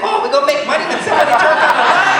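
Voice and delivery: high, loud mocking laugh-voice; mocking the crazy kid who sold it. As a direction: mimic the silly guy, laughing. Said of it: "He's like".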